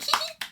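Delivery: mock giggle. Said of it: "and then I was like".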